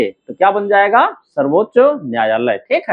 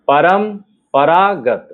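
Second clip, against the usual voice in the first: slow परंपरागत, split परम... परागत; measured dictation of परंपरागत for writing; syllable by syllable; much slower than usual. Shown as a demonstration. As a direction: say परंपरागत in long pieces with a pause. Word dictated in pieces.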